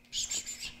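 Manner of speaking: short voiceless "psch" bursts; whispered run of shushes; shushing. Real, not acted. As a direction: shush in quick repeated puffs. Shushing noises while playing.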